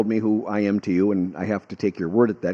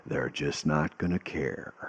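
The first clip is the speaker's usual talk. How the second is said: lower, slow and soft grave mentor impression; Charlton Heston voice; 6 semitones lower, 12 dB quieter, slower than usual. Storyteller voicing his bearded mentor's verdict, lower and slow. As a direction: lower and slow, a grave pronouncement from behind the big desk. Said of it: "the voice like Charlton Heston".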